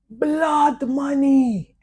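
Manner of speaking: high, long drawn-out yell of blood money; retold shout. Drunk clubgoer yelling across the club, long drawn-out shout. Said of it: "he shouts".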